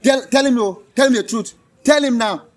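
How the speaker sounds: loud, high angry demand. Real, not acted. Man ordering his partner to confess, very angry. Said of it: "I'm very angry right now".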